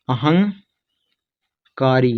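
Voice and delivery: अहंकारी in two parts, long pause before kaari; very slow sounding-out. Shown as a demonstration. Teacher breaking the word down for students, very slow with a long pause. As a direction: split अहंकारी into ahang, then kaari, very slowly.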